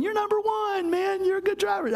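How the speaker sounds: high, sarcastic fake praise for a bad driver; mock cheerful praise. Pastor mocking angry drivers, high sarcastic praise.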